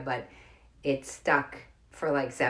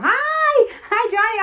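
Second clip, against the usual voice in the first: high, loud delighted squeal; excited greeting; 11 dB louder, much slower than usual. Real, not acted. Greeting Johnny on the live stream, excited squeal. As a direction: call out hello, loud and high.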